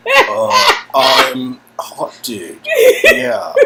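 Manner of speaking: very high, loud and slow; goofy loud. Acted. Introducing himself to Steve as Hot Dude, goofy loud.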